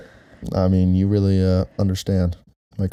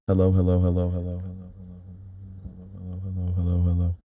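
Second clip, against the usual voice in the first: hellos fading far off the mic, then coming back; mic test moving off-axis; 4 dB quieter than usual. Shown as a demonstration. Host demonstrating the directional mic to his wife, fading off-mic and back. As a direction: swing away from the mic and return while repeating hello. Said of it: "So I'm gonna go like this".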